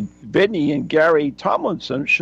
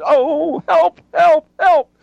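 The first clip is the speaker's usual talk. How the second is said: panicked cry for help; 12 semitones higher, 5 dB louder, much slower than usual. Acted. Voicing the couple caught in wind.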